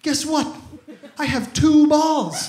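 put-on high, loud little-boy voice; proud toddler. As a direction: announce it slowly like an amazed small child. Toddler brother announces his discovery.

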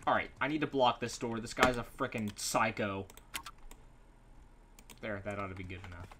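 quick, low talking to himself; panicked muttering while barricading; hurried mutter. Real, not acted. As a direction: rush it under your breath.

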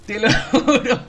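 laughing loudly while swearing it. Real, not acted. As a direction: giggle through the words. Laughing confession.

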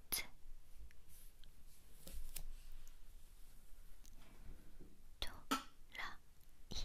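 slow, broken-up whisper; syllable by syllable. Acted. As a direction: whisper each syllable apart. Syllables drawn out one by one.

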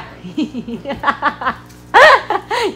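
high, loud laughter; real laugh. Real, not acted. Laughing over how YouTube is said, real laugh.

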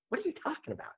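high, incredulous squeak of confusion; startled, high-pitched what-are-you-on-about; baffled disbelief. Retold or imitated. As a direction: ask it like you can't believe your ears. Retelling confused reply to billionaire.

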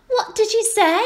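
put-on very high child's question; questioning little girl. A narrator's reading. Little girl with hands over ears asks, high-pitched. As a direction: ask it high and girlish.

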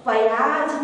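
प्याज with a full प, as pa-yaaj; full first syllable. Shown as a demonstration. Teacher showing learners the reading to avoid, full-प pronunciation. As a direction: say प्याज wrongly drawn into two beats, pa-yaaj. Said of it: "प्याज नहीं बोलते हैं".

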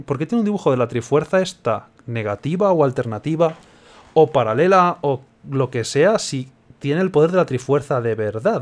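usual speaking voice

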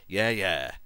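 ordinary delivery

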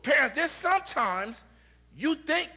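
loud, high-pitched preaching; prédica enérgica. Acted. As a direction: preach it out at full force to the congregation. Preaches at full force.

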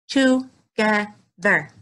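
Together stretched slowly, beat by beat; jumped syllables. Shown as a demonstration. Teacher clapping and jumping syllables with kids, slow beats. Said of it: "clap and jump the syllables".

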